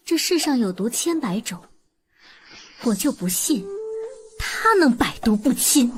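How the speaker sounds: ordinary talk, nothing marked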